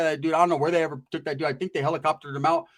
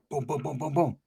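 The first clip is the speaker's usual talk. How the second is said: quiet, muffled knock noises through a wall; imitated knocking on the cell wall; soft knocking sounds; 2 semitones lower, 6 dB quieter than usual. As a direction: tap it out like knuckles on concrete.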